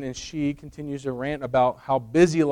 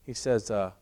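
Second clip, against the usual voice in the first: usual speaking voice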